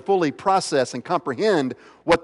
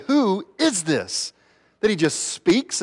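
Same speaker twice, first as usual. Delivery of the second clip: slow, higher-pitched awed question; amazed disciples; 5 semitones higher, slower than usual. Retold or imitated. Disciples stunned as Jesus calms the storm, amazed. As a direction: draw out the question in wonder.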